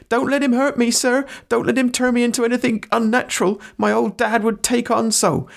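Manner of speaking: very high, frightened servant cry; high-pitched fearful plea; quaking. Acted. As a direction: appeal to the master in a high, panicky voice.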